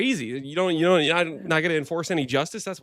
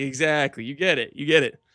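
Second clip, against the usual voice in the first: ordinary talk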